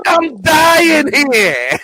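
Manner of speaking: high, very drawn-out mock shout; hammed-up dying shout. Patient yelling past the woman at the window, hammed-up dying shout. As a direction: stretch "dying" into a melodramatic holler.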